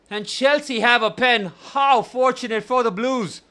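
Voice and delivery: high, loud, breathless commentary call; excited play-by-play. Acted. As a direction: call the penalty like a live commentator.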